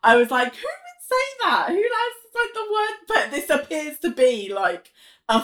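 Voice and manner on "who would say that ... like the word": high-pitched, mock-baffled questioning; incredulous. Viewer reacting to a character's odd phrase, incredulous.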